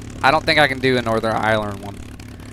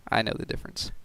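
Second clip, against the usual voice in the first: whispered, softer aside; 2 semitones lower, 13 dB quieter, faster than usual. Real, not acted. Whispered aside to British viewers. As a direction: breathe it as a quiet aside.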